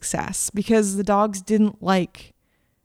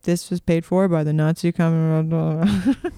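political attack ad disclaimer; 4 semitones lower, slower than usual. Retold or imitated. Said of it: "And then it's like".

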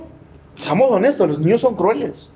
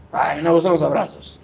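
mock fussy churchgoer; 6 semitones lower, faster than usual. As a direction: imitate a prissy church member refusing hugs. Imita a un miembro quisquilloso. (imitando a un hermano quisquilloso, más grave)

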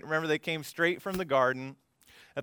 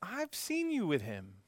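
lightly voiced servant girl, higher and softer; 5 semitones higher, 6 dB quieter than usual. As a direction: point him out softly, voice lifted. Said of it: "a servant girl says".

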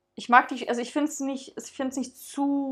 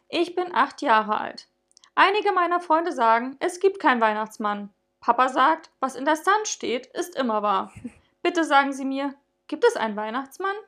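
put-on higher, louder earnest child voice; a girl of eight pleading in her letter; young girl's letter; 3 semitones higher, 5 dB louder than usual. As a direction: read it like an eager little girl.

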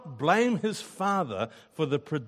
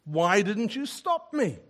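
re-enacted protest to God, raised pitch; mildly acted cry of reproach; mild retold shout; 5 semitones higher than usual. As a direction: plead upward in a half-shouted complaint. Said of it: "shouting at God".

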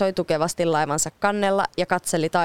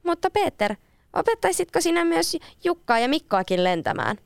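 put-on much higher, childlike girl's plea; young girl voice; 10 semitones higher than usual. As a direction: ask it as an eager little girl. Leena pleads with Peter.

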